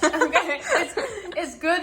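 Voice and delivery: loud laugh through a mouthful. Real, not acted. Laughing while eating.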